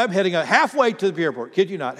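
ordinary delivery

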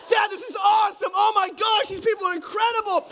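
high, shouted hammed-up glee; overjoyed child. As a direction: shout like a thrilled little kid. Daughter now loves the gym.

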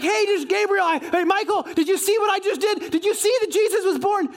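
high, eager boasting to the angels; excited. Acted. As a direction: play someone unable to contain the news. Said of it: "I get excited".

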